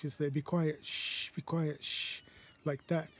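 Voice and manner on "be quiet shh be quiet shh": soft English "shh" while saying "be quiet". Retold or imitated. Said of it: "you say in English".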